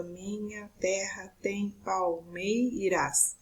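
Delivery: slow, separated syllables of "palmeiras" and the words before it. Shown as a demonstration. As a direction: split every word into its syllables, slowly. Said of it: "nós vamos fazer a divisão gramatical".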